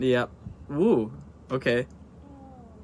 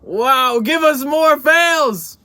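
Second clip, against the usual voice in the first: slow, loud, high hype; drawn-out enthusiastic demand; excited; 10 semitones higher, 7 dB louder, much slower than usual. Real, not acted. Hyped request.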